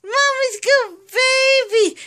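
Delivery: put-on "Mommy's good baby" high, stressing "good"; high sing-song. Shown as a demonstration. Owner modelling the phrase for her parrot, high sing-song. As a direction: lift it high and sweet for the bird. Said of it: "all right".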